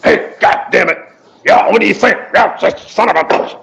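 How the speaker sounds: put-on rough cussing good-ol'-boy voice; Rusty impression. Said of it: "Here's Rusty".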